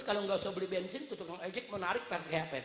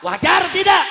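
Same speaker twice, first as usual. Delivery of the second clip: high, full-force shout to the crowd; rallying shout; 9 semitones higher, 22 dB louder than usual. Real, not acted. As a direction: belt out the question to rouse them. Rousing the crowd, repeated louder. (teriakan lantang membakar massa)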